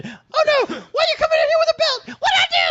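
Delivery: put-on very high, fast panicky child voice; frightened kid. Kid seeing mom coming in with a belt, very high panic. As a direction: squeal like a scared kid facing a belt.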